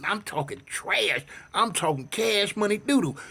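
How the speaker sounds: ordinary delivery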